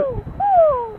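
high hooting owl. Retold or imitated. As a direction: hoot like an owl. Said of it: "I can do an owl sound".

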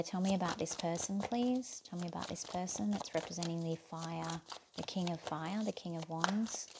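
soft murmur to the cards; murmured request. Real, not acted. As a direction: ask quietly, half to yourself.

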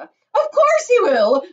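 high, loud, drawn-out shriek. A narrator's reading. Mother contradicts chocolate maker, shrieking. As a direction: shriek back insistently. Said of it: "shrieked Missus Gloop".

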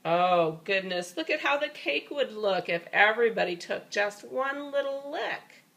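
ordinary delivery

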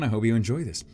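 ordinary talk

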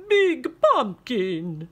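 slow, loud vampire. Acted. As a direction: drawl like a vampire. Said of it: "said the vampire".

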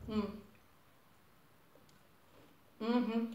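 chewing a crumbly cookie; humming while eating; mouth full, chewing. Real, not acted. Seen 1: Eats cookie pieces from hand, visibly chewing through the words.

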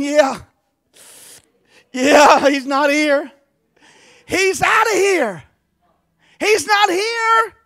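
loud, rising, half-crying shout of joy; the angel crowing that the tomb is empty; exultant angel shout, voice breaking. Pretend. Preacher voicing exultant angel.

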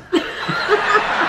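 high breathy laugh; high cackle. Real, not acted. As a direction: burst out cackling. Laughs at own bit.

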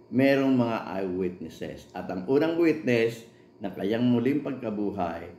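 ordinary talk, nothing marked

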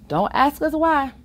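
usual speaking voice

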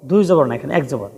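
usual speaking voice

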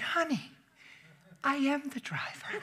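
high and soft, tender; preacher telling woman he was the driver; gentle reveal. Retold or imitated. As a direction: break the news tenderly.

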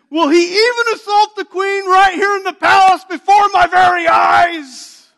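high, loud, crying-out fury; a king's high, loud, shouted outrage, slowing at the end; outraged shout. Retold or imitated. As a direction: scream it at the offender. King finds Haman on queen.

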